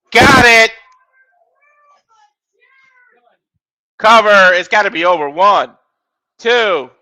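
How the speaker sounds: rapid ringside calls rising on big moves; live play-by-play. Acted. Commentator calling a wrestling match to viewers, energetic play-by-play. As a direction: call the match as it happens.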